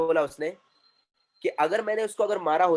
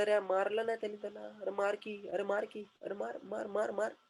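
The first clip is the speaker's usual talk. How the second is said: much softer, muttered egging-on; hushed urging; 2 semitones higher, 10 dB quieter than usual. Retold or imitated. Storyteller as Indian bystander watching a fight, hushed urging. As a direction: mutter as an onlooker willing the blow to land.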